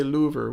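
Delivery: "louver" with an oo in place of short i; mispronounced liver. Shown as a demonstration. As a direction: say "louver", LOO-ver, for liver. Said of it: "has a lot of oohs in it".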